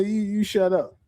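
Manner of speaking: ordinary delivery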